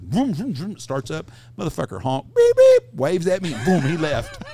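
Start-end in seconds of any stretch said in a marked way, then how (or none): 0.0-0.7 mimicked engine revving, loud and high; engine vroom. Teller imitating his truck pushing the car, engine noise. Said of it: "and I said". 2.3-2.9 very high, loud horn imitation; horn beeps. Friend driving off honking, shrill beep beep.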